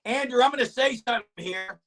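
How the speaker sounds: ordinary talk, nothing marked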